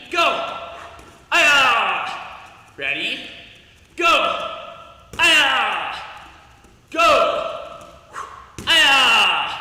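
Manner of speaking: loud high shouted go and kiai; coach shouting commands and kiai. Real, not acted. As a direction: drive the class with shouts. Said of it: "coach calls go, kiai shouts".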